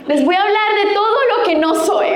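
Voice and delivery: amused, laughing admission. Real, not acted. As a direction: laugh while confessing the irony. Jokes about preaching on marriage.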